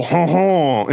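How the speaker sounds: high, lightly puffed huh huh, a cliché French chuckle; a hint of a cartoon Frenchman chuckling; mock French laugh. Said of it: "there's not a single".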